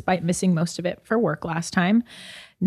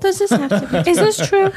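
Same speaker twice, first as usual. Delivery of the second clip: put-on high, whiny child; little kid voice; 12 semitones higher, 3 dB louder than usual. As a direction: squeak it like a small kid. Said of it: "You're like".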